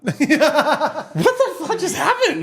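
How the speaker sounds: high, loud, drawn-out laughing disbelief; real helpless laughter through the question; laughing bewilderment. Real, not acted. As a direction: crack up in utter confusion.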